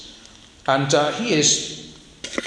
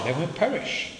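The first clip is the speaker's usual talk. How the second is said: ordinary talk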